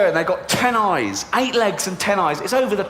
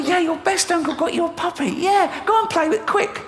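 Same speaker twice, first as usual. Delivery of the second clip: high mock kiddy voice; high singsong; 12 semitones higher than usual. Uncle to niece, high mocking sweetness. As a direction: talk down to the child in a high sweet voice. Uncle voicing himself, mimicking child.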